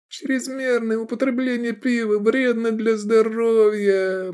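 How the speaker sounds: high, slow, monotone nasal-free drone; droning. A narrator's reading. Hungover Edik reciting a warning, high droning monotone. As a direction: recite it on one dreary high note. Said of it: "пробубнил Эдик".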